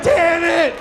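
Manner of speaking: high mock guilty curse; high, loud mock dismay; caught dog owner. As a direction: play the busted owner. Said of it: "He's like".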